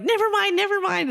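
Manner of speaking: high, retold panicky dismissal; flustered brush-off. Storyteller waving off worried partner, flustered brush-off. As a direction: wave him off in a high voice.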